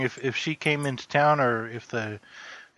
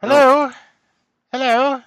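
high-pitched, raised hello; calling out; 13 semitones higher, 7 dB louder than usual. Acted. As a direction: call out questioningly towards the cottage. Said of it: "I will call out".

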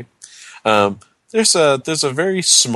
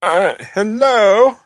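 high, distant hello from outside; muffled voice behind a door; 11 semitones higher, 5 dB louder, slower than usual. Acted. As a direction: call faintly from the other side. Visitor calls from behind door.

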